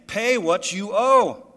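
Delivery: loud, slow, high furious demand; choking demand. Acted. Servant to fellow servant, a loud slow furious demand. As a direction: squeeze the debt out of him. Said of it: "he began to choke him".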